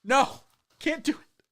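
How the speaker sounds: mock Chinese accent. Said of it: "Try doing the stream with a Chinese accent".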